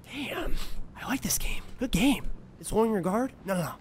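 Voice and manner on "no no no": usual speaking voice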